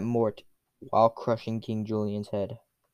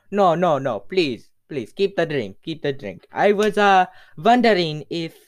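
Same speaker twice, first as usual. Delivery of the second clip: higher, louder character voice; insistent refusal; 5 semitones higher, 6 dB louder than usual. Acted. Hero waves off the king's drink, high and insistent. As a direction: protest politely but loudly.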